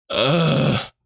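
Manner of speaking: short exasperated groan; acted groan. Jane reacting to Betty's familiar speech, acted groan. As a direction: groan as if heard it all before. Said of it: "She's like".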